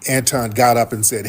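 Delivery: ordinary delivery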